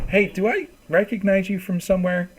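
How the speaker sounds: a bright sudden question, as if half-recognizing him; curious woman NPC. Acted. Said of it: "she kinda looks back and goes".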